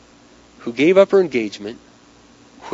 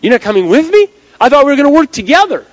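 loud, high acted alarm; gasping disbelief; 12 semitones higher, 9 dB louder than usual. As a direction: protest in rising panic, as if just being abandoned. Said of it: "And she kinda gasps".

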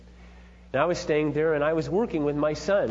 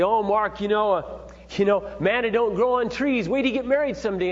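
higher, louder mocking dad voice; cutting father; 7 semitones higher, 4 dB louder than usual. Father mocking his son before the wedding, higher and louder. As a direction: sneer it like a belittling father. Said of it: "say real cutting remarks".

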